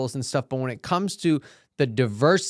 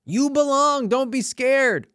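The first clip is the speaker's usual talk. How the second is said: much higher and louder, rallying; excited cheer; 12 semitones higher, 5 dB louder, slower than usual. Shown as a demonstration. Urging fellow actors not to be scared, a high loud rallying cheer. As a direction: cheer it out like a pep talk. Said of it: "very important lesson in it".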